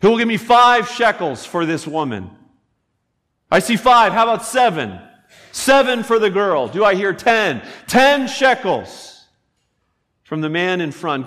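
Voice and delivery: loud, high auction call; auctioneer. Retold or imitated. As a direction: cry out the bids like an auctioneer. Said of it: "the auctioneer crying out".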